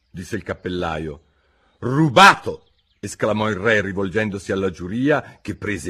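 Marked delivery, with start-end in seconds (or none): 1.8-2.7 loud, higher accusing cry; a king crying foul; king's exclamation. Acted. King accusing the Hatter before the jury, loud.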